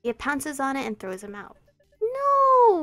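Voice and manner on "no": high, loud cry of no; dismayed shout. Acted. Player out at first, loud dismayed shout. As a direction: yell it as the out is called.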